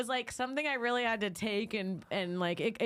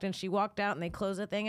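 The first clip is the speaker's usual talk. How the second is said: normal talking voice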